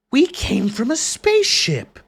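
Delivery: loud, high and slow, disbelieving; yelling it out in disbelief; exasperated shout. Real, not acted. Exasperated at game dialogue.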